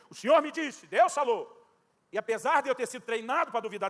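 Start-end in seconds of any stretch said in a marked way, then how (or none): 0.1-1.6 higher and louder, proclaiming; imitating his friend's constant claim to hear God, higher and louder; mimicking a prophet friend. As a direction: announce it like a confident prophet. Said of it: "volta e meia ele dizia".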